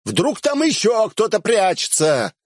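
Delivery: mocking high call; taunting call down the road; calling after her. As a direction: shout the tease after her. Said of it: "закричал я ей вслед".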